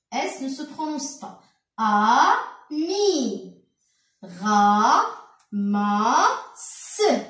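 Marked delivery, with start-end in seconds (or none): 1.8-7.2 each of "A, mi, Ra, ma, se" held separately; chant-like syllable drill, one beat each; sounding out syllables. Shown as a demonstration. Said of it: "on va lire tout ça".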